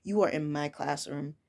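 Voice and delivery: low, measured telling-off; calm, controlled no-nonsense correction; firm teacher. Retold or imitated. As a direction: lay it down quietly to a small child. Teacher firmly correcting a child.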